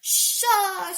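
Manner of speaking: high, triumphant yell; drawn-out victory cry. A narrator's reading. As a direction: celebrate a perfect hit. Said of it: "she yelled".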